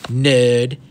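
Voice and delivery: acted bully's sneer. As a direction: throw the insult out loudly. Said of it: "he sneered".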